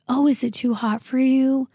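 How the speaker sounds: the father taunting his daughter in a mocking voice. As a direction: needle her with fake sympathy. Father taunting the scalded child.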